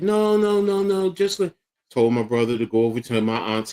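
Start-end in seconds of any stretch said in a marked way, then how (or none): 0.0-1.5 high, urgent imitation of a mother; pitched-up pleading, words run together; imitated pleading. Mother begs son not to leave, imitated pleading.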